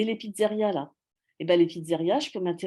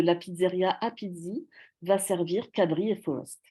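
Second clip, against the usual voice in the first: ordinary talk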